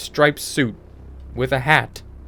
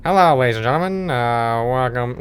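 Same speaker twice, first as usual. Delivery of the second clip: put-on fast, formal old-timey greeting; imitating an old-timey gentleman; old-fashioned announcer; 3 dB louder than usual. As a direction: greet the crowd like a vintage emcee. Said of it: "You know, they talk like that".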